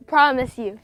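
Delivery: ordinary delivery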